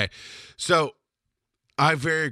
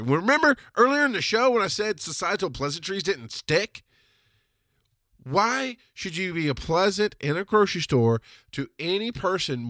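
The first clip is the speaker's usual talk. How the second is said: normal talking voice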